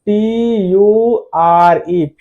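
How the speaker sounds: slow, separated letters; spelled out letter by letter. Shown as a demonstration. Teacher spelling future for students, slow and deliberate. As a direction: spell it out slowly for the class. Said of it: "और future".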